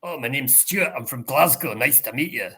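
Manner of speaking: thick, punchy Glaswegian Scottish accent; strong Glasgow accent. Shown as a demonstration. Tutor showing how someone from Glasgow sounds, very strong Glasgow accent. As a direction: introduce yourself in broad Glaswegian. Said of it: "it has a very strong accent".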